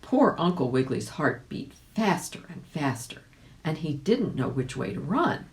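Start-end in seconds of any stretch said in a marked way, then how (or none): none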